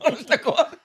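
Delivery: high, laughing telling. Real, not acted. Telling the roundabout story while laughing hard. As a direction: push the story out through laughter.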